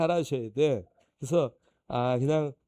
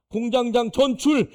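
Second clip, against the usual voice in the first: slow triumphant proclamation, louder and higher; announcing the transfer; 7 semitones higher, 9 dB louder, slower than usual. Retold or imitated. Pastor reading the plant manager's transfer, loud and gloating. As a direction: read the posted notice out like a verdict.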